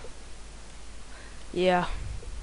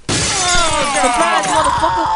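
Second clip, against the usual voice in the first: higher, louder gleeful taunt; spring it on them and gloat; 5 semitones higher, 5 dB louder, slower than usual. Pretend. Gamer ambushing a game victim, gloating loud taunt.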